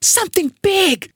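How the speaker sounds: high, strained hiss; tense, high-pitched warning; hissed alarm. A narrator's reading. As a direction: squeeze it out high and urgent. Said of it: "he hissed".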